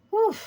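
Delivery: blowing out a deep breath; long exhale. Real, not acted. Psychic letting go of pent-up energy with a long exhale. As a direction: let the breath out slowly. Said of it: "I'm gonna take some deep breaths here".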